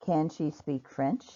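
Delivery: slow, with the voice rising on "French"; rising question intonation. Shown as a demonstration. Teacher models a yes/no question, rising intonation on "French". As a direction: let "French" lift upward at the end. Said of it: "my intonation will rise at the end".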